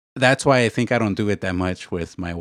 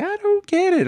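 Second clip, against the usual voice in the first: much higher, puzzled voice; high-pitched wife voice. Retold or imitated. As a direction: squeak it out like his wife. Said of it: "She'll be like".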